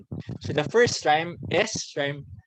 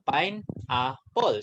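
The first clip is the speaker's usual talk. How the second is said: "Pine, apple" broken apart slowly with a pause; slow syllable split; much slower than usual. Shown as a demonstration. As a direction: stretch and split the word into parts. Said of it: "let's have pineapple".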